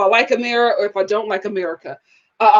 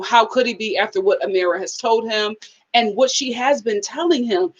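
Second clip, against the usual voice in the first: normal talking voice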